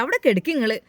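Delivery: ordinary delivery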